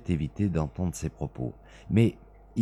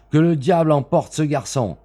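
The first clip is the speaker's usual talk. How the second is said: loud, higher angry outburst; angry exclamation; 7 semitones higher, 7 dB louder than usual. A narrator's reading. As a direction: curse him out loud. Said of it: "s'écria Smith". (exclamation furieuse)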